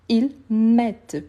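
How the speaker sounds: "mettent" slow and stretched, with a clear final t; sounded T. Shown as a demonstration. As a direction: draw out "ils mettent", landing on the t. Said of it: "le T, c'est le son d'accent". (« ils mettent » lentement, avec le t bien prononcé)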